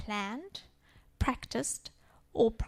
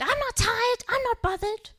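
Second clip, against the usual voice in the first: high, loud mock child scream; imitating a child's scream; 12 semitones higher, 7 dB louder than usual. Daughter screaming back at mommy at the door, high mock shout. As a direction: shout it like a furious little girl. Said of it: "she screamed".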